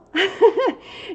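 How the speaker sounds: loud, high, helpless laugh; laughing through near tears; burst of laughter. Real, not acted. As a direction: break into delighted laughter. Overcome with joyful laughter.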